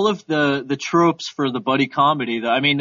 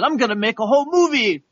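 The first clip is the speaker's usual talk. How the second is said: high, eager director impression; enthusiastic Verhoeven; 6 semitones higher than usual. Imitating a director announcing his whole movie concept, enthusiastic. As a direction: pitch it up with exclamation-mark enthusiasm. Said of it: "it's not the accent, it's the enthusiasm".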